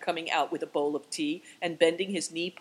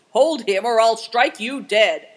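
slowing, forceful yell; shouted threat; 5 semitones higher, 12 dB louder than usual. A narrator's reading. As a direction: shout a desperate warning. Man threatens as dog holds him.

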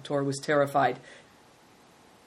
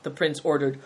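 plain reading voice

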